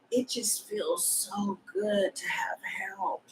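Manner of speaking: slow, hushed whisper; whispered relief. Acted. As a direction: whisper it slowly, savouring the relief. Dreamy aside on having help.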